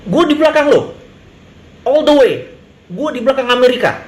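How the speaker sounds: a leader hamming up his promise of loyalty to America; mock loyal ally. Acts leader flattering America.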